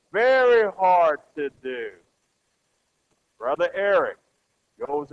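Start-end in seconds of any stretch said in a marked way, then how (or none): 0.1-1.9 loud, drawn-out "very hard"; heavy emphasis. Real, not acted. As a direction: lean slowly and loudly on "very hard". Repeats answer with heavy emphasis.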